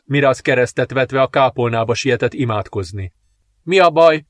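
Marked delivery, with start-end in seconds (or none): 3.6-4.3 loud, higher, breathless pained question; panting in pain. A narrator's reading. Princess in labour demanding answers, panting in pain. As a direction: gasp it out through the labour pains. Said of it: "kérdezte a hercegnő zihálva fájdalmában".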